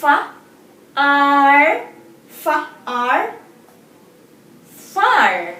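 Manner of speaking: Fa and ar split, then blended into far; sounding out words. Shown as a demonstration. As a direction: sound out Fa, ar, then join them. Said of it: "That is letter".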